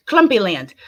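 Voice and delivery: ordinary talk, nothing marked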